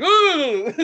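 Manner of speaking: high, loud "ooh"; imitated noise. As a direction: mimic a sudden high sound, then laugh. Imitating radio noise on waking.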